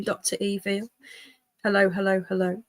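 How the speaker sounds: normal talking voice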